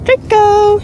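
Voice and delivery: loud high call across the field; calling a dog. Real, not acted.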